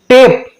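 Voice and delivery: टेप with a drawn-out "ay"; long A. Shown as a demonstration. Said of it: "इस ए का उच्चारण ए किया". (टेप में लंबा ए)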